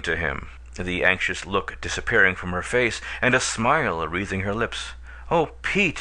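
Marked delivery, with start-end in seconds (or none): 5.3-6.0 high, bright and eager; lightly voiced girl, bright and eager; bright greeting. A narrator's reading. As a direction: greet him gladly, voice lifted. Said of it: "she began brightly".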